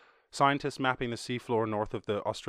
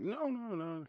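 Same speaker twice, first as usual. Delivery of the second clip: high mock denial; 10 semitones higher, 7 dB quieter than usual. Voicing friend's denial.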